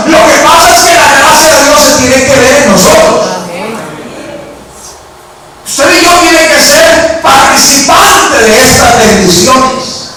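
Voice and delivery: high, forceful sermon cry; impassioned preaching. Real, not acted. Preacher telling the church grace must show in them, impassioned. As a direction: start high and shouted, ease down at the close.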